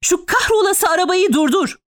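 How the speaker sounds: high, urgent cursing command; exasperated high-pitched shout to the driver; Fevzi crying out. A narrator's reading. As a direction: yell the order with the pitch way up. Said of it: "diye haykırdı Fevzi".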